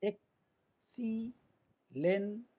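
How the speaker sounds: Excellent very slowly as एक … सी … lent; long pauses between three beats; teacher splitting excellent into parts; syllable by syllable. Shown as a demonstration. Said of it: "x हो गया".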